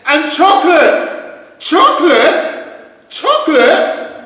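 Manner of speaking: very high, loud echoing call, slowing; cave echo. Acted. The duck fakes the cave's echo, high, loud and echoing. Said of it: "the voice from the cave replied".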